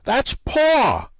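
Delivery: called out high across the room; mock shout to clear up the mix-up; retold yell across a room. Someone at the party correcting Kathy, calling out high. Somebody yells from the other side.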